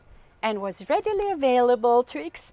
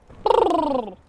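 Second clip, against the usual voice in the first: excited laugh; 5 semitones higher, 7 dB louder than usual. Acted. After the thunder rumble.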